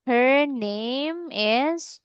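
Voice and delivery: drawn-out, word by word; very slow modelling. Shown as a demonstration. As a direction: stretch each word for the learner. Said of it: "Can you say that?".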